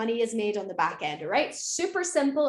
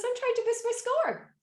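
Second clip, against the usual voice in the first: much higher, a pleading driver; high mock driver voice; 10 semitones higher than usual. Imitating the Uber driver pleading for a good rating. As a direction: squeak it up as the eager driver. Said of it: "He goes".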